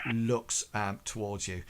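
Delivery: normal talking voice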